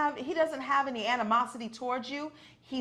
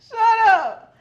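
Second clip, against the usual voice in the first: laughing shout; 13 semitones higher, 14 dB louder than usual. Real, not acted. Reacting to the joke.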